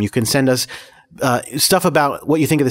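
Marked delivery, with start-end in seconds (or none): none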